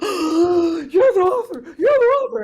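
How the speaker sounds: loud, high, drawn-out fangirl cry; freaked-out fan. Retold or imitated. Fan realising she's met the author, freaking out. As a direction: imitate an overwhelmed fan squealing in recognition. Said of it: "she freaked out when she realized".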